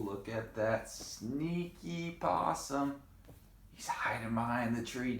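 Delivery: ordinary delivery, nothing marked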